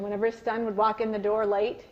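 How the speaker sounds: ordinary talk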